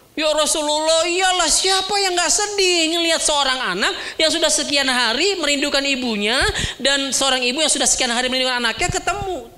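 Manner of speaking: high, tearful answer with a half laugh; weepy companions. Acted. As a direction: answer choked with emotion. Companions answer through tears.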